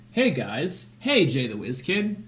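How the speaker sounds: ordinary talk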